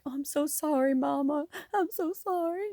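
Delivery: put-on high, apologetic little-girl plea; imitating tearful daughter. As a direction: plead sorry like a remorseful daughter. Daughter apologizing to mom, retold.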